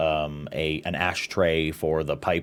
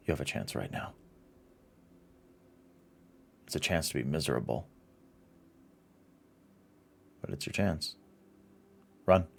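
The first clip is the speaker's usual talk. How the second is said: dramatic scene narration; 4 dB quieter than usual. Acted. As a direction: set a dark scene quietly. GM describes menacing scene.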